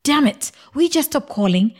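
husband cursing at the ringing phone, angry and trembling. A narrator's reading. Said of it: "with tremors and anger in his voice".

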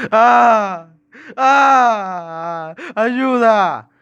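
high, loud, drawn-out screams; desperate screaming. Acted. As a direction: scream for help in terror. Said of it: "empezó a gritar desesperado".

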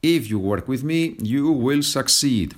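a hint of an ad promising success; commercial voice. Teacher hams up the line like a commercial. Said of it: "This looks like a commercial".

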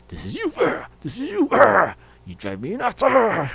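high, loud effortful yell with each lift; mock strained grunts. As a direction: push the bar and spit the words out. Friend grunting while lifting weights.

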